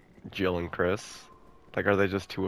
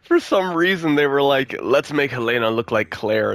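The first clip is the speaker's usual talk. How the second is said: loud mocking; 6 semitones higher, 8 dB louder than usual. Mocking the developers' decision.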